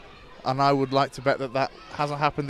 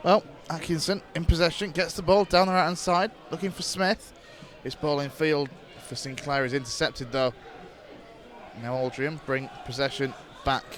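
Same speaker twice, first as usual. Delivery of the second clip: steady radio match commentary; live play-by-play; 2 semitones lower, 6 dB quieter than usual. Acted. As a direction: call the match as it unfolds.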